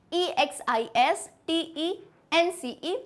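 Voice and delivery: letter by letter, slow and even; slow spelling out. Shown as a demonstration. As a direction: spell each letter slowly for pupils to repeat. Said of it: "spelling दो बार अपन repeat करेंगे".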